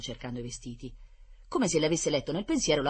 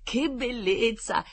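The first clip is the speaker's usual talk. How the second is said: high, drawn-out wonder; delighted exclamation; 9 semitones higher, 4 dB louder, much slower than usual. A narrator's reading. Blond boy hearing about the pizza, exclaiming in delight. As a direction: marvel slowly, lifting the pitch. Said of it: "esclamò il biondino".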